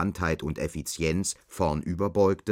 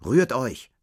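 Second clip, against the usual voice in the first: loud barked order; barked drill commands; 6 semitones higher, 6 dB louder than usual. Acted. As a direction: bark it like a drill instructor. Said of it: "bellte die Übungsleiterin".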